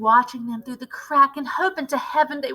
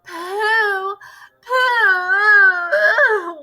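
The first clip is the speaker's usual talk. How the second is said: disgusted outcry; 7 semitones higher than usual. Acted. Witches disgusted by stench.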